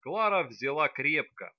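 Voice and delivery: ordinary delivery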